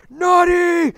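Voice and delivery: high, loud screamed impression of a killer; mock scream. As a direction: scream it out like a rampaging maniac. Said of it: "running out screaming".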